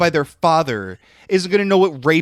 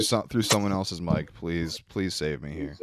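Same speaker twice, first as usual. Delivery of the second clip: ordinary talk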